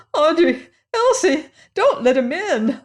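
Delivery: high, loud panicked cry. A narrator's reading. Old aunt crying out in panic to the girls. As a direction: shriek in terror to stop them. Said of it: "in a panic".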